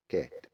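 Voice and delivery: كات with an open, short "a". Shown as a demonstration. Teacher reviewing at the board, short A in كات. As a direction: say كات with A as in "apple". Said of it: "زيها بالظبط".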